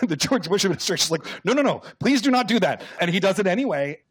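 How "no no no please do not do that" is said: high, frantic pleading no no no. Retold or imitated. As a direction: beg them to stop, voice jumping high. Administration begging him to stop.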